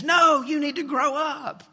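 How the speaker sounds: high, slow mock shout from the sister; a sister snapping back, high and loud; bossy big sister. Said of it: "the sister says".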